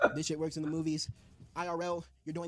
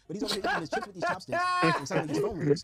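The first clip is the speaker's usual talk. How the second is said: excited; 7 semitones higher, 10 dB louder than usual. Real, not acted. Excited retelling of manga scene.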